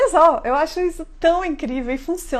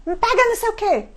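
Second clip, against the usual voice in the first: mock child yelling; 9 semitones higher, 3 dB louder than usual. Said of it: "ele falou de novo".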